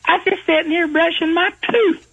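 high, hammed-up country girl; high silly girl greeting granny; young girl voice. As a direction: squeak it out like a goofy young lady. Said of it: "And she says".

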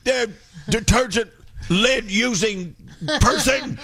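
high, halting call; play-acted villager's jeer; mock mob taunt. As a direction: heckle him from the castle gate, groping for the insult. Mob calling at castle door.

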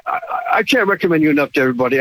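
ordinary talk, nothing marked

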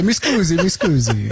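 put-on jokey Italian-accented apology; mock Italian accent. Co-host adding to the Italian woman bit, mock Italian accent.

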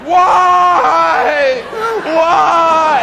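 very high, loud mock cry of grief; hammed-up anguish. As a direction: wail it at the sky like a movie hero. Mock anguish after princess falls.

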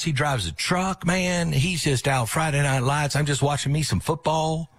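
high, hammed-up Texas twang; a hint of a Texas good old boy; mock Texas accent. Mocking Jimmy as Texan.

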